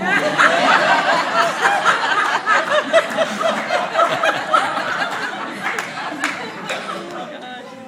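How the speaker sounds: long high burst of laughter; long loud laugh. Real, not acted.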